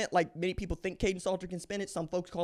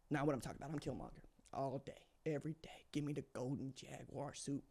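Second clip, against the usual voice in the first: much softer, half whispered; hushed; 5 semitones lower, 15 dB quieter than usual. Real, not acted. Fan admitting his Killmonger love, hushed. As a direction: confide it under your breath. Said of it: "hushed fan confession".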